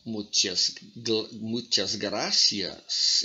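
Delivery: muchas gracias with a slow lisp, the c in gracias as th; lisped Spanish. Shown as a demonstration. Teacher imitating the king's lisp, slow lisped demo. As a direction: lisp "muchas gracias" slowly, tongue between the teeth. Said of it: "falava assim".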